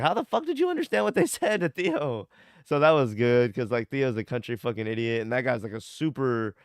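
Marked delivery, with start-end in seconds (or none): 0.0-2.3 baffled reaction. Retold or imitated. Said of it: "And I'll be like".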